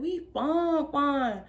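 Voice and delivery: high, lingering emphasis on "fine, fine"; drawn-out slang admiration. Retold or imitated. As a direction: imitate sister dragging out "fine, fine". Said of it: "that's how we talk".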